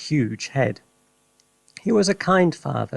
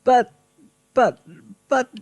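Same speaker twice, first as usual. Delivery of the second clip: stammering; 11 semitones higher, 4 dB louder than usual. Acted. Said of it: "stammered George's mother".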